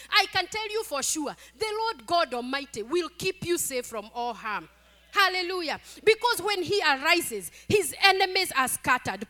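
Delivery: loud, high-pitched, fervent preaching; full-force preaching. Real, not acted. Preacher proclaims God's protection to the congregation, fervent full-force preaching.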